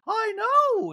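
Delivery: very high, drawn-out outraged impression; Dorit. Housewife gossiping to roommate about glam, shrill scandalized impression. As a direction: gossip in a piercingly high put-on voice. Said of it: "my Dorit".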